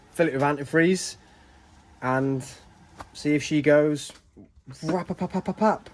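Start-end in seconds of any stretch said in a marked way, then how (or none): 4.8-5.9 higher, rapid-fire engine noise made by mouth; imitated engine putter. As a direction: sputter like an engine catching.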